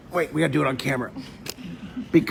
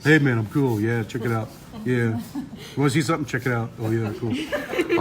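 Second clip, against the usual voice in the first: lower, laid-back cool dude voice; cool teen; 5 semitones lower than usual. Pretend. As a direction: lightly play the too-cool teenager. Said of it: "I'm just gonna do this all the time".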